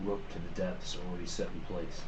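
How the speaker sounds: low, hushed in-character murmur; pretend whisper. Player quietly passing word about the rope, pretend whisper. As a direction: say it softly, as if whispering. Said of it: "I whisper".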